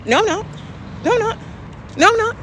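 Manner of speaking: a mock petulant whine repeated; mocking denial. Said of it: "And she just says".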